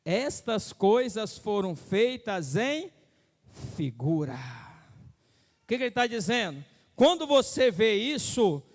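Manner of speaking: high, loud preaching build; urgent pulpit exhortation. Acted. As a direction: drive the warning home from the pulpit. Preaching at full force.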